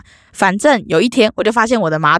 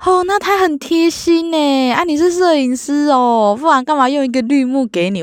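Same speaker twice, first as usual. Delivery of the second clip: mimicking landlord; 7 semitones higher than usual. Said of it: "他还说".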